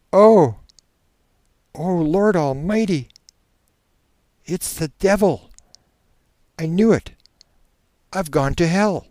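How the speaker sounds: high, loud, anguished dread; horrified. Acted. Subject tells hypnotist the devil is here, horrified. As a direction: cry out in separate bursts of horror. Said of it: "in a horrified explanation".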